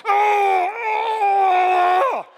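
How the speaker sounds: long, high moan of pain; agonized groan. Shown as a demonstration. Preacher showing what gnashing of teeth is, agonized wail. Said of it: "there's so much pain, you're going".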